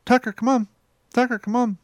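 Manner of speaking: high-pitched talking-bird voice; parrot calling the dog. Retold or imitated. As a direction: imitate a parrot summoning the beagle. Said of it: "she used to say Tucker's name".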